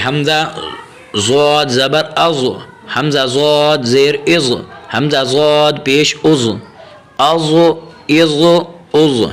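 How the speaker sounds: slow, measured Arabic letter drill; teacher reciting a Qaida drill slowly; Qaida drill recitation. Shown as a demonstration. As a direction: chant the letters like a Quran teacher.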